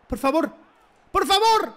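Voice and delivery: high, loud desperate plea; pleading yell. Real, not acted. Streamer yells in hope at a chance. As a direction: beg the ball to go in, at full voice.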